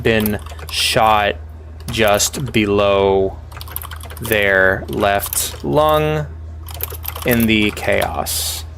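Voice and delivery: very slow, drawn-out narration; slow story reading. A narrator's reading. Streamer reading the game's text, very slow. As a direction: read each phrase slowly, with pauses. Reads game narration slowly.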